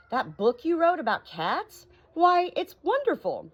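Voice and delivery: high, loud and slow gushing; excited praise. Acted. Cat's meat man gushing to the doctor, slow and high. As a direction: gush over the book.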